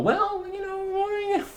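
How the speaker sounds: high, slow, mincing mock voice; mock the vegans in a prissy falsetto; mock preachy vegan. Vlogger mocking preachy vegans, in a high mincing falsetto.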